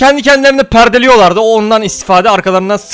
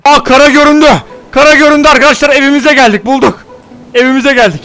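higher, louder excited discovery; 5 semitones higher, 4 dB louder than usual. Real, not acted. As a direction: land ho, thrilled. Spotting land after sailing.

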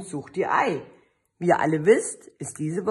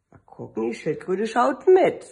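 ordinary delivery

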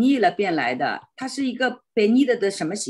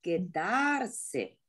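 "Quedarse" softer, stressed on a drawn-out "da"; 重音在大; 7 dB quieter than usual. Shown as a demonstration. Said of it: "你怎么念？". (轻声示范“Quedarse”，重音落在da)

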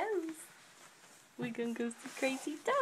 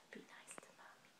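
whispering; 24 dB quieter, faster than usual. Real, not acted. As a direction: whisper it gently. Whispered repeat of the request.

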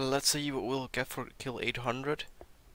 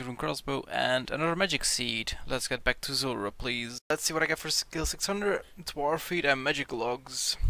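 usual speaking voice

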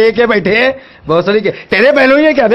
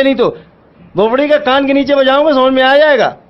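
heated, swearing rebuke; angry outburst; 3 semitones higher than usual. Real, not acted. Shopkeeper threatens to slap the pestering caller, angry. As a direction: lay into the caller, voice pushed up.

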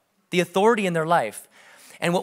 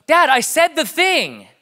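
put-on higher, slow, laughing child protest; protesting daughter; 9 semitones higher, 4 dB louder, slower than usual. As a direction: plead it like a little girl who did her part.

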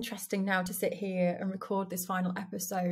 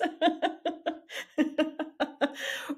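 amused laugh; 7 semitones higher than usual. Real, not acted. Joking about recording over sleeping.

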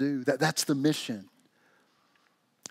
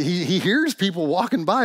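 ordinary delivery

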